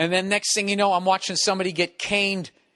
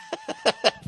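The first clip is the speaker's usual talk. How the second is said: high squeaky laugh; 3 dB quieter than usual. Real, not acted. Laughs at own voice crack.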